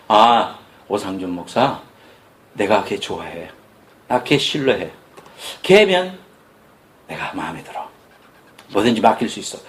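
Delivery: a hint of God speaking tenderly; God's voice. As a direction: speak as God fondly praising his servant. God voiced praising the pastor.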